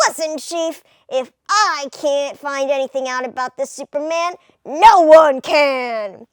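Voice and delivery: a hint of a nerdy reporter. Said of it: "Clark's gonna have a nerdy voice".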